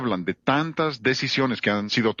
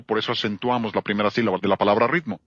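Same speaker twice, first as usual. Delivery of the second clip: ordinary talk, nothing marked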